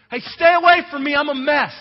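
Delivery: high, loud "stay away" cry; yelling warning. Retold or imitated. Outcast woman warning people at the mall, yelling. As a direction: yell it out so people keep clear. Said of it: "top of your lungs".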